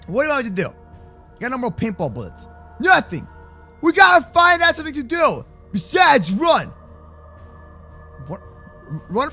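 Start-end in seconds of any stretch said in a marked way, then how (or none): none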